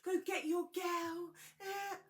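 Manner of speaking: high-pitched imitation of weepy friends; slow into a high, whiny fake cry; mock tearful cheering. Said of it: "Helena and Meg are there crying".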